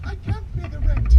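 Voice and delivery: high, pleading damsel voice; damsel in distress. Acted. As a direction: squeak it like a helpless heroine. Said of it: "a damsel".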